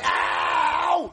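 pained shout. Pretend. Pain from needle in head.

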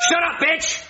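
shrill insult thrown out high; sudden high snap. Acted. Doctor suddenly lashing out at the voiceless wife, high and shrill.

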